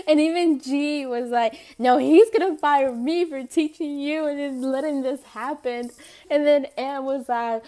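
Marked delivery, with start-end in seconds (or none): 1.8-5.9 louder, fast-rising protest; mock panicked protest. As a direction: insist over them in mock panic. Coworker panics about blame.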